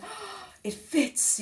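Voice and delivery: high, slow mock delight; imitating the thrilled teacher. Teacher seeing the dress fit, high mock delight. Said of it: "She said".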